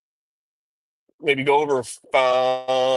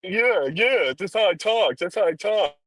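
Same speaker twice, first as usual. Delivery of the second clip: higher, put-on street voice, trying too hard; mocking her fake gangster talk; 6 semitones higher than usual.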